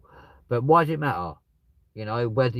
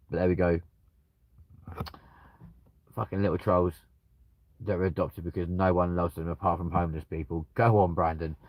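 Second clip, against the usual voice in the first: usual speaking voice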